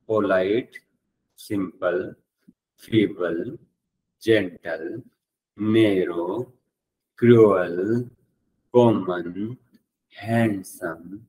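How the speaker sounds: drawn-out words, each lifting at the end; word-list drill. Shown as a demonstration. Teacher listing adjectives for students to repeat, measured rising chant. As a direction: recite one word at a time, with pauses. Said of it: "वापस मेरे साथ repeat करो".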